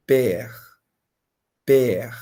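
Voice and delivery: père said slowly with open e, for students to repeat; model French word. Shown as a demonstration. Teacher models père for students to repeat, clearly. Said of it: "Répétez avec moi".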